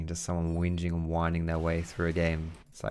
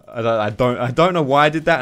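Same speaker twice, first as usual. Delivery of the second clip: 1 incredulous laughing; 4 semitones higher, 7 dB louder than usual. Real, not acted. Disbelief at his misplay.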